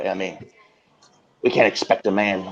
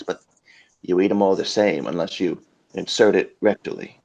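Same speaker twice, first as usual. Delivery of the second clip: ordinary delivery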